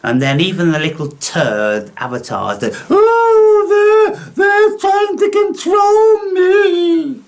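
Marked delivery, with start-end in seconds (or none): 2.9-7.2 shrieking mock whine, much higher and louder, slow; mock whiny victim. Mocking the avatars who think they're controlled, high slow mock whine.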